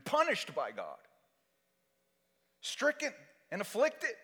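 high, shouted proclamation; impassioned preaching. Real, not acted. Preacher crying out Isaiah to the congregation, impassioned shout. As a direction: cry it out at full force, pitch soaring.

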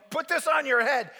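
acted insistent directive; bossy order. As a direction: command it like a pushy nurse.

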